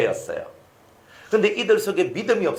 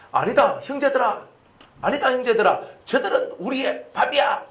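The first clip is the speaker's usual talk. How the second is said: high, earnest plea to the brothers; rally a doubting crowd, pitched up; retold urging; 7 semitones higher than usual. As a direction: imitate a confident scout appealing to his people. Acting Caleb and Joshua urging brothers.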